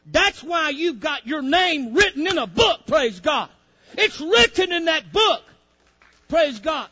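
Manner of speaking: loud, high, shouted preaching; full-force preaching. Acted. Preacher rouses the congregation about the book of life, shouting. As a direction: lift the church with it.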